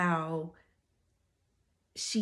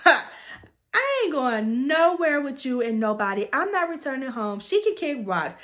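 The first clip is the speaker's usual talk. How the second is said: a retold outburst rejecting the minister; going off; 6 semitones higher, 6 dB louder than usual. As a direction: throw it back at him, higher and louder. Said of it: "Girlfriend goes off".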